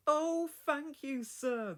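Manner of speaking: high, loud, slow delighted thanks; grinning. Acted. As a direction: beam with relief and joy. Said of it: "grinned Thomas".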